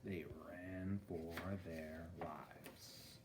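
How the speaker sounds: slow, soft whisper; hushed whisper. A narrator's reading. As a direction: breathe it out slowly and quietly.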